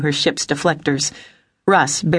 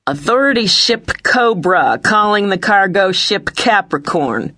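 slow, higher official hail; blaring comm voice; 4 semitones higher, 3 dB louder, slower than usual. A narrator's reading. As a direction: hail another ship over the comm, slowly. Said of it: "blaring over the comm".

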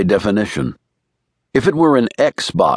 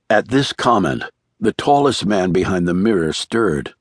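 ordinary delivery

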